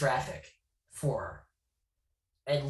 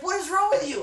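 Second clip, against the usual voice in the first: incredulous friends; 6 dB louder than usual. Retold or imitated. Friends reacting, retold.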